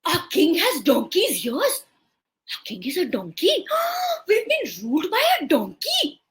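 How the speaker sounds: mild scandalised murmur of townsfolk; gasping, rising disbelief; shocked public. Acted. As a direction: gossip in disbelief. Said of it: "everyone in the kingdom said".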